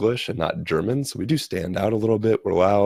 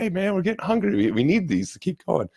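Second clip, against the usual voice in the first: impatient group complaint; 12 semitones higher than usual. Retold or imitated. Group's playful complaint, acted out.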